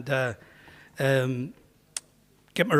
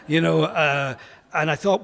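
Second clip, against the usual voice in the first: ordinary talk, nothing marked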